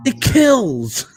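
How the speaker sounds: high, loud drawn-out punchline; William Shatner impression. Shatner boasting about his show, high loud impression. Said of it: "He said Shatner approached him".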